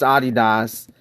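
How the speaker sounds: Adidas said AH-dee-dahs; German-style Adidas with open ah vowels; German pronunciation. Shown as a demonstration. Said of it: "find out it was pronounced".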